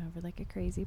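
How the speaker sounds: whispered confession; whispering. Real, not acted. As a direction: whisper it, a bit embarrassed. Confessing childhood habit.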